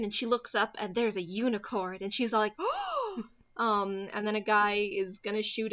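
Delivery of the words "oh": long, high, soft "oh" of wonder; mock amazed gasp. Retelling Odette seeing a unicorn, mock amazed gasp. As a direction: imitate an awestruck gasp, pitched way up.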